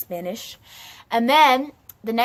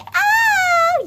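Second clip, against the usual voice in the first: very high squealed oh; delighted squeal; 9 dB louder than usual. Acted. Starla tastes her unicorn drink, high squeal.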